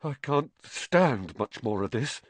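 ordinary delivery, nothing marked

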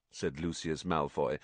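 usual speaking voice

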